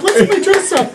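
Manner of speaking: put-on high, sing-song little kid; squeaky childish repeat. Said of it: "like four-year-olds".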